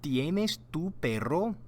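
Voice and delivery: ¿Tienes tu perro? said slowly, rising at the end; slow Spanish question. Shown as a demonstration. Teacher models Maria's question for students to repeat.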